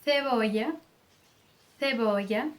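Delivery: "Cebolla" with English th for the C; tongue between the teeth to start "cebolla"; Spain-style "thebolla"; lisped C. Shown as a demonstration. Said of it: "Com a língua entre os dentes".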